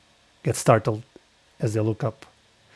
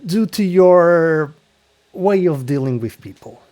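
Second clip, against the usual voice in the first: game master narration. Acted. Game master setting the scene for the player.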